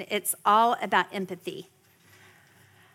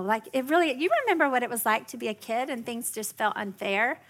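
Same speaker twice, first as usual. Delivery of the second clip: ordinary delivery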